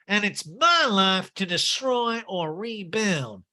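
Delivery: very slow, dramatic; hammed-up slow pronouncement; mock Erica. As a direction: draw it out grandly. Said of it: "And Garcelle goes".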